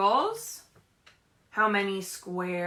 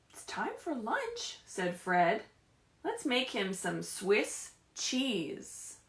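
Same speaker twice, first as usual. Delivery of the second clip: lively, sing-song picture-book reading; animated storybook voice with questions to the kids; reading to children. Acted. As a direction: read it like story time for little ones. Teacher reads picture book aloud.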